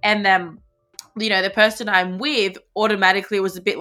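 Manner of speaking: ordinary talk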